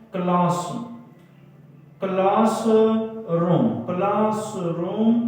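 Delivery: CLASSROOM drawn out as two slow parts, "class - room"; syllable by syllable. Shown as a demonstration. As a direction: say "classroom" slowly, split before "room". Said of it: "दो syllable से इस्तेमाल हुए हैं". (Classroom को धीरे-धीरे, दो हिस्सों में तोड़कर)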